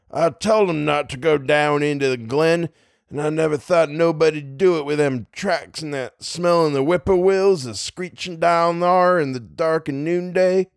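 backwoods New England farmer's dialect; rural dialect. Acted. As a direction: drawl it like an old hill farmer in backwoods dialect. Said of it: "there's just more dialect".

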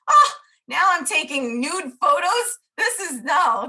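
half-laughing panicked objection; flustered protest. Retold or imitated. Told to take nude photos, she protests in mock panic.

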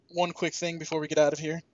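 ordinary delivery

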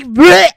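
loud mock gag; gagging sound. Shown as a demonstration. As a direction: imitate a gag reflex. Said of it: "that's my gagging sound".

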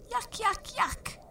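very high squeaky little voice; tiny girl crying out. A narrator's reading. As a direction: squeal it like the tiniest child. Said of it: "cried Totty".

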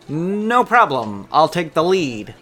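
high, slow, confident little man; slow, high and loud little fellow; small man's voice. Acted. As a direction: puff up like a tiny gentleman.

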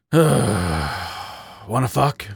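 very slow, resigned proposition; loud sigh. A narrator's reading. Badger propositioning his ex at closing, sighing. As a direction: sigh heavily, then ask wearily. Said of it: "He sighed loudly".

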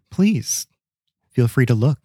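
smug invitation, opening on a high lilt; lightly self-satisfied, mock-gracious offer. A narrator's reading. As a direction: offer it as if nothing could be found. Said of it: "he did so quite smugly".

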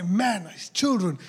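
loud, building prophetic declaration; full-force preaching. Acted. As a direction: proclaim it over the children with rising force.

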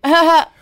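loud, high fake "ha ha"; fake laugh. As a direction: imitate a forced courtesy laugh. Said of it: "[name] was fake laughing".

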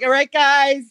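high, loud call upstairs to nobody; calling pretend family. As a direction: summon imaginary guests to eat. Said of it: "but I'm like".

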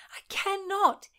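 high hushed disbelief; whispered. A narrator's reading. Constance whispers, unable to believe it. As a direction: whisper it to yourself.

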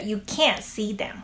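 can't punched, t nearly dropped; natural You can't see them with the weight on can't; stress on can't. Shown as a demonstration. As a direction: hit can't, let the t fade. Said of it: "再听一次".

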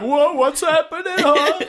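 mock bafflement. Acts out baffled thugs in retelling.

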